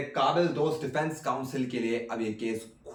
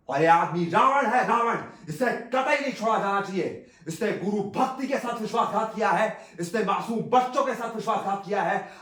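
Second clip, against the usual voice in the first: high-pitched, indignant courtroom tirade; impassioned accusation; 7 semitones higher than usual. Acted. Lawyer demands the death penalty for betrayal, high and outraged. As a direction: denounce the accused with rising outrage.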